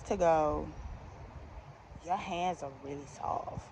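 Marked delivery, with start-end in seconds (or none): 2.0-3.7 quiet, low imitation of a flirting customer; soft flirty compliment. Customer compliments her hands mid-fitting, soft and flirty. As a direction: murmur it like a coy come-on.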